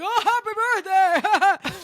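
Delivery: very high, loud, slow sing-song; exaggerated fake cheer; drawn-out high syrupy greeting; mock sweet drunk. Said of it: "He's like".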